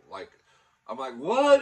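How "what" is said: shocked what. Retold or imitated. Shocked reply to the cop.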